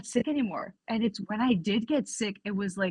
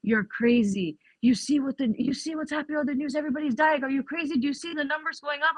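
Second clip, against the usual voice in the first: mock family scolding; 6 semitones higher, faster than usual. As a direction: hurl it back at her as if she's lost it. Family calling her crazy.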